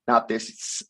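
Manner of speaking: ordinary talk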